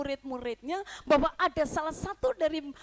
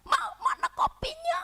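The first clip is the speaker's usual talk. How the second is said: much higher, louder mock demand; mock yell; 5 dB louder than usual. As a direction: call out bossily to the wife. Husband demanding coffee loudly. (suami berteriak minta kopi)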